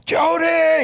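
high calling shout of Jody; yelling for wife. Retold or imitated. Pastor calling his wife, a high yell. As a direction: call her name from across the house. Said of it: "He started yelling for his wife".

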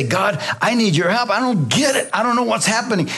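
high-pitched, exasperated cry to God; acted-out frustrated prayer; frustrated plea. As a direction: plead in frustration, pitch pushed up. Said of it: "Frustrations are made so that you would".

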